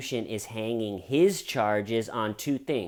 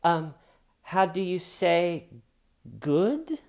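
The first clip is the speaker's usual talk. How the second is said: high, halting fake Spanish accent; hammed-up Spanish-accented hesitancy; mock Spanish accent; 7 semitones higher, slower than usual. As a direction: grope for English in a put-on Spanish accent. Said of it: "Spanish accent".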